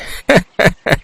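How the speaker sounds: short chuckling evil laugh; gloating villain laugh. Acted. Headman laughs over the fields he seized, gloating.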